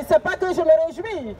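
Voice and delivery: normal talking voice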